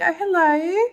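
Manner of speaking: high, sweet little dove greeting; voicing the dove. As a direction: play the dove, delighted and coy. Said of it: "this dove is just like".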